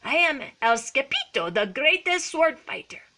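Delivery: put-on high, grand play Spanish accent; boastful kitten hero. A narrator's reading. Skippy introduces himself to the Chihuahuas, proud boast. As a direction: proclaim it proudly.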